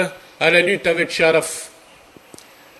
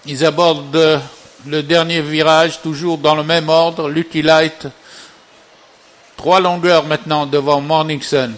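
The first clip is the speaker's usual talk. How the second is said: race caller; 3 dB louder than usual. Real, not acted. Live horse race call, final turn.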